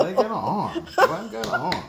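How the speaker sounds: laughing through the words. Real, not acted. Laughing at broken machine.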